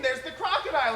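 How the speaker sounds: ordinary delivery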